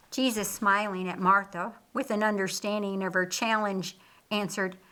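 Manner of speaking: plain reading voice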